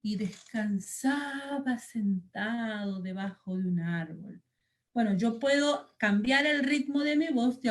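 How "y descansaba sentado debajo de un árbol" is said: slow, soft storytelling; unhurried, hushed story pace. Shown as a demonstration. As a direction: drag the words out to show someone strolling. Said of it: "yo puedo hablar más rápido cuando una persona corre o cuando".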